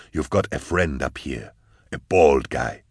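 low-voiced, understated menace; captor's taunt. A narrator's reading. Captor talking to his hostage, low taunting voice. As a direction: keep it low, toying with the prisoner.

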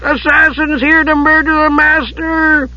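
high, drawn-out wail of alarm; howl. A narrator's reading. Monk raising alarm for his master, howling. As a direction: howl it slowly on a high pitch. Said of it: "he howled".

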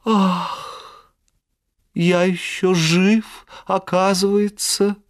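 slow moan, a touch high. Acted. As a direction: groan it out weakly. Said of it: "простонал".

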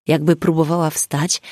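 ordinary delivery, nothing marked

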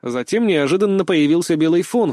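ordinary delivery

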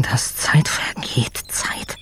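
slow voiceless whisper, fading out. Acted. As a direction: whisper it slowly, almost gone. Desperate inner voice at night.

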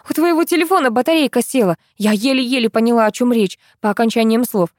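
ordinary delivery, nothing marked

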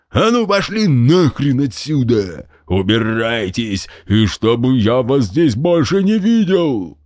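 slow, menacing general's threat; threatening general. A narrator's reading. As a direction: loom over the kids and threaten. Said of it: "грозно расставив руки".

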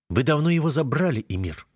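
words pushed through gritted teeth. Acted. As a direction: hold back rage, teeth clenched. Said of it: "сквозь зубы бросает парень".

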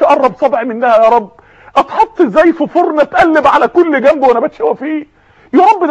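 hoch, verzweifeltes Betteln bei Gott; flehender Sünder. Acted. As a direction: jammernd um Gnade flehen, ich kann nicht. Sünder fleht am Jüngsten Tag.

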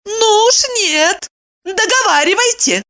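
high-pitched indignant insistence; pounce, refusing to let it drop; flaring up. A narrator's reading. Woman refusing to be brushed off, flaring up. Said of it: "взвилась она".

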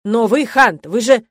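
loud, indignant scolding; angry rector. A narrator's reading. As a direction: raise your voice at the student, then falter.

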